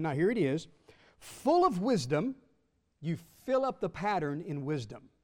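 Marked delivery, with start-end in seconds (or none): 1.2-2.3 Full lifted high, loud and slow; ringing emphasis. Acted. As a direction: lift "Full" and stretch it out. Quoting scripture with emphasis.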